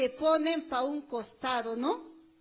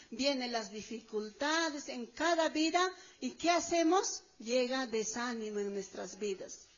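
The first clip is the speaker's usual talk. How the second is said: normal talking voice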